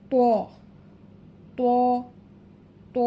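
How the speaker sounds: ত held for one beat, a long full "to"; drawn-out letter name. Shown as a demonstration. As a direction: say ত thick and stretched. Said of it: "এক আলিফ পরিমাণ টেনে পড়ব". (ত এক আলিফ টেনে মোটা করে)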